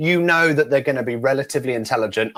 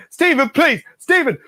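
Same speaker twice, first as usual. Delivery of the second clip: high-pitched mock begging; acted frantic appeal to a friend; desperate pleading; 12 semitones higher, 4 dB louder, slower than usual. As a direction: call his name more urgently each time. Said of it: "then he starts going".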